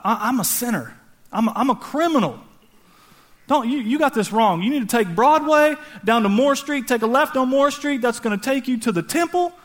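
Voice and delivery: acted incredulous pleading; flustered protest. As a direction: stop them and set them straight. Acted shepherd protesting to the angels.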